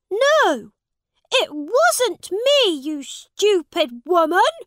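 high, loud, furious denial; boy shouting denial at the woman; angry shout. A narrator's reading. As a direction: shout back at her. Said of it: "shouted Edward".

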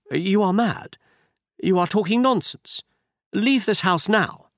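a hint of a frightened noblewoman; Lady Hilda voiced. A narrator's reading.

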